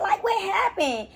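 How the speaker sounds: high, half-laughing disbelief; high mock outrage with a laugh; incredulous. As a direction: squeal out the question in mock shock. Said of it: "I'm just like".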